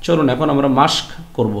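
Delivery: normal talking voice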